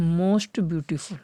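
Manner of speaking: very slow, dictating the phrase; dictation pace. Shown as a demonstration. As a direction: drag it out for students to write. Dictating to the class. (लिखवाते हुए बहुत धीमे)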